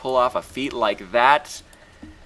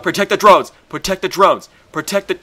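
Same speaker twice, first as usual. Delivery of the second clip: loud, much higher repeated cries; urgent shouting; 10 semitones higher, 12 dB louder than usual. Real, not acted. Gamer panicking over his drones, urgent shouts. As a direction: yell it like an alarm.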